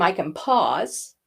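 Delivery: slow it right down; slow pause demo. Shown as a demonstration. Voice coach showing pupils a slowed pause. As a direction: stretch it out so the pause lands. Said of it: "using pause".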